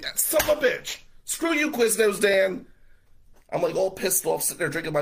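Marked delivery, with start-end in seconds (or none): 0.0-2.7 angry retort. Retold or imitated. Narrator snapping back at the rival who mocked him, loud angry retort. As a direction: spit it out at him, loud and high.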